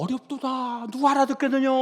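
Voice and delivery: high, intoned scriptural quote; complaining disciples. Acted. Preacher quoting disciples who find Jesus' words hard, high intoned complaint.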